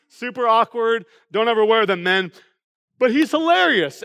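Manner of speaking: normal talking voice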